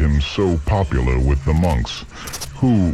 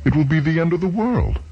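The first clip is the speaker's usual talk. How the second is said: high, fast rush of alarm; alarmed realisation; 11 semitones higher, faster than usual. Acted. George realising the monks' plan, high fast alarm. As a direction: blurt it out in sudden dread.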